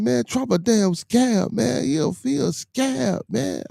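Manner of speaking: high, drawn-out mock complaint; imitating the neighbor. Retelling what the neighbor said, high drawn-out impression.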